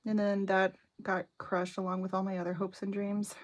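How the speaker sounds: ordinary delivery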